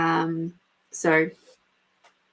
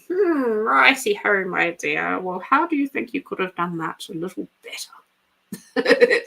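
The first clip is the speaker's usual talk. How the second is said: a hint of a patient old woman coaxing; wise old crone. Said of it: "the wizened old crone in the corner going".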